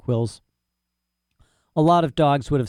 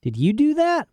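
much higher, outraged question; wrathful; 14 semitones higher than usual. Acted. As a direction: demand it, shocked and angry. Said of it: "they looked kind of wrathful".

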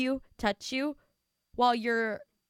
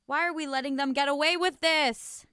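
louder, higher exasperated plea; indignant rant; 4 semitones higher, 6 dB louder than usual. Real, not acted. Host ranting about boyfriends who get away with it, indignant. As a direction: demand it with theatrical outrage.

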